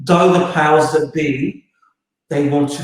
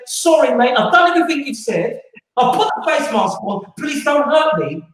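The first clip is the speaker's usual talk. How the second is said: hammed-up cowering plea, higher, half laughing; mock pleading; 8 semitones higher than usual. To the authorities, a mock begging plea. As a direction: beg like a beaten man, mockingly.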